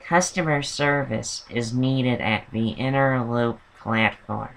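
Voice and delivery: slow, soft imitation of a weary announcer; tired Fran Drescher. Mimicking the annoying transit announcer for listeners, tired Fran Drescher voice. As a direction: drag out a train announcement in a hungover voice. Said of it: "She's like".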